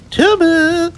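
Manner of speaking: very high put-on posh mother voice; high falsetto mum; Mummy's voice. A narrator's reading. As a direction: squeal it out like a fussy mummy. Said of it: "said Mummy".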